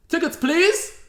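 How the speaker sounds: high mock inspector call; high sing-song conductor voice; ticket inspector.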